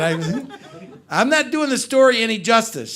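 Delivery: normal talking voice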